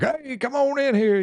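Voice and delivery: high, drawn-out Southern holler; hammed-up oilman welcome; boisterous oilman. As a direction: imitate a big over-the-top Southern gentleman. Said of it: "boisterous, like, he sounds like a".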